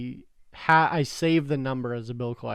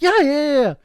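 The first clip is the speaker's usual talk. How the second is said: eager collector; 12 semitones higher, 10 dB louder than usual. Retold or imitated. Eager collector voiced in story.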